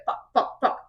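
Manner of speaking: whispered curses in panic; panicked whisper. Retold or imitated. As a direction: hiss the curses under breath. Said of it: "She's like".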